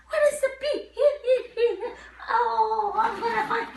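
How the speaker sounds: put-on game-show excitement for kids; playful searching. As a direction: pretend to search eagerly. Teacher playing letter hunt game.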